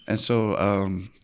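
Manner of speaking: normal talking voice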